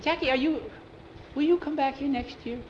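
put-on high-pitched boyish asking; high, hopeful little question; earnest boy's question. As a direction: ask a friend earnestly, voice way up. Boy asks his friend about returning.

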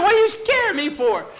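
high, loud shaken complaint; flustered yell at his friend; rattled protest. Acted. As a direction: still jumpy from the fright. Just got scared by Jackie.

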